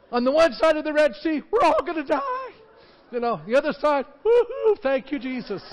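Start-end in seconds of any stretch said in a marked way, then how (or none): 1.5-2.5 high-pitched hammed-up panicked wail; mock panic. Israelites on the near shore in mock panic. 4.2-5.6 high, loud whoop into thanks; joyful whoop. Retold or imitated. People saved on the far shore, whooping with joy. Celebrating on the other side.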